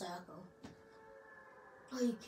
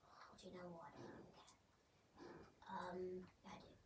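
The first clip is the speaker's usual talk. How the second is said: exasperated whisper; 3 semitones lower, 18 dB quieter than usual. Real, not acted. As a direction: whisper it, fed up. Annoyed whisper aside.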